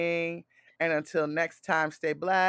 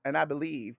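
ordinary talk, nothing marked

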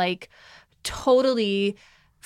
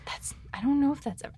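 whispering; 5 semitones higher, 7 dB quieter, faster than usual. Real, not acted. Muttered aside to herself.

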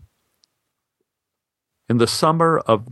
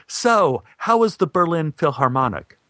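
eager question; 6 semitones higher than usual. Retold or imitated. Energetic man asks eagerly.

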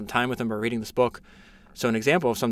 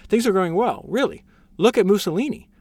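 higher, mock-cheery minister; higher, mocking cheer; mock upbeat reassurance; 8 semitones higher, 5 dB louder than usual. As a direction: toss it off with teasing cheer. Rereads minister's quote, mocking.

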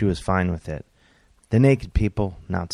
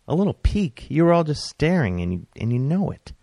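much higher, outraged squeak; shocked and accusing; indignant disbelief; 9 semitones higher, 4 dB louder than usual. Retold or imitated. Indignant at being stared at.

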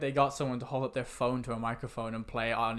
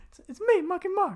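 much higher, thin, bad [name] voice; Mark Wahlberg impression. Cohost asks for Wahlberg impression, much higher bad impression. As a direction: badly fake Mark Wahlberg, laughing midway. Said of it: "Can you do a Mark Wahlberg impression?".

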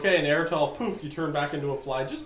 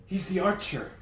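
high voice building to a shout; rising, loud outburst; furious recognition; 9 semitones higher, 9 dB louder than usual. Acted. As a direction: burst out louder as it dawns on you. Caden's dawning recognition, fire erupts.

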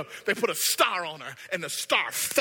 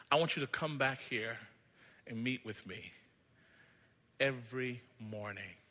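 tender maker voice; 7 semitones lower, 9 dB quieter than usual. Acted. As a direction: ask him lovingly to return. Wicker maker invites boy.

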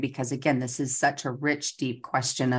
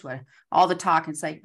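ordinary talk, nothing marked

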